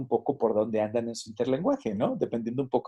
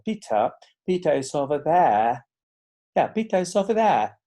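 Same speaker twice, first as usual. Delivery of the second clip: fluent British "Pita is ova there"; advanced British accent, no r's; 5 semitones higher, 4 dB louder than usual. Shown as a demonstration. Teacher shows the advanced British version, a bit louder. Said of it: "yo esperaría en inglés británico".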